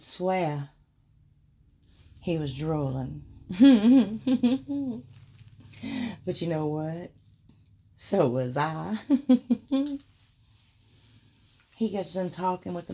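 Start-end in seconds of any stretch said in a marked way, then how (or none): 3.3-6.2 high loud burst of giggling; loud high giggle. Real, not acted. Laughs at her own remark. 9.0-10.0 high loud burst of giggling; loud high giggle. Real, not acted. Laughs at her own remark.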